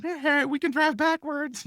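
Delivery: very high, slow singsong teasing; taunting the fire chief from the ramp; mocking taunt. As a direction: gloat in a silly cartoon voice. Taunting fire chief while taxiing.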